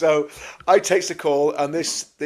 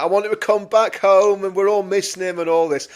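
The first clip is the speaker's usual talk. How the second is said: higher, mildly plaintive quoted voice; raised pitch, retelling her plea; imitated pining mother-in-law; 6 semitones higher, 5 dB louder than usual. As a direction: lightly act out her missing him. Voicing mother-in-law missing Paul.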